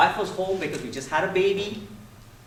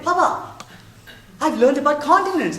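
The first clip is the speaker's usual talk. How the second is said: put-on high, louder excited little-girl voice; excited child; 9 semitones higher, 8 dB louder than usual. Daughter coming home excited to tell her papa. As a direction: burst in thrilled like a small child.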